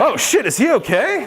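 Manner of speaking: mock worried biker. Biker asking, high laughing voice.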